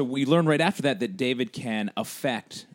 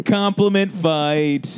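drawn-out, higher game-show call; mock announcement; 6 semitones higher, 4 dB louder, much slower than usual. Host jokingly declaring a contest of compliments, mock announcer. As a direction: announce it in slow, sing-out mock grandeur.

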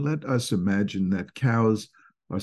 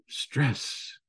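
a soft whispered stress; 4 semitones higher, 6 dB quieter than usual. Acted. Lecturer summing up the scolding, in a whisper. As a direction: breathe the word out, voiceless. Lecturer sums up the boss scene.

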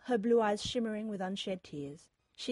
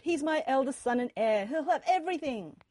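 raised, louder insistent voice; loud protest; 6 semitones higher, 6 dB louder than usual. A narrator's reading. Father arguing his heir has everything, loudly protesting.

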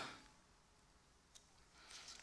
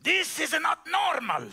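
put-on a light Cuban accent, a bit higher and raised; Miguel's Cuban accent; 6 semitones higher than usual. Preacher quoting his Cuban mechanic, light Cuban accent. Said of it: "Mister Miguel used to say".

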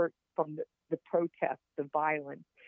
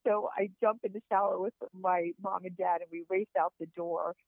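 usual speaking voice

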